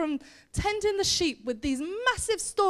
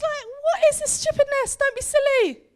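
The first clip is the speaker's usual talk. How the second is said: very high, loud scolding; indignant disbelief; 11 semitones higher, 5 dB louder than usual. Acted. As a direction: scoff at the nonsense, pitched right up. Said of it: "She's like".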